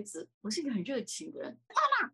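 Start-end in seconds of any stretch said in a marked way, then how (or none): 1.7-2.1 put-on high, loud, childlike shout of "妈妈"; bright exuberant "妈妈" yelled across the street; eager loud call. Shown as a demonstration. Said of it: "我一定会这样".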